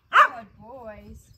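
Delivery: loud, hearty praise. Real, not acted. Trainer praising two playing dogs, loud and hearty. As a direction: boom it out to reward the dogs.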